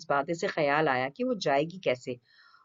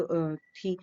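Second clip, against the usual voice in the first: ordinary delivery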